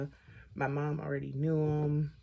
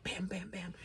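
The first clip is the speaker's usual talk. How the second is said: imitated knocking; 4 semitones higher, 7 dB quieter than usual. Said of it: "And I'm like".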